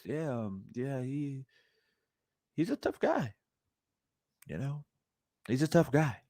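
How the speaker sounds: very soft, low, hesitant mock voice; Ryan Garcia. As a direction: mumble it meekly, mocking his low-key manner. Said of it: "can talk like this".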